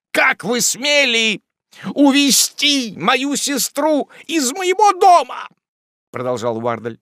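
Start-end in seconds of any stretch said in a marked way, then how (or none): 0.1-5.5 high, drawn-out indignant yell; slow, loud accusation, voice pushed far up; outraged shout. A narrator's reading. Wardle confronts the man who ran off with his sister, outraged shout.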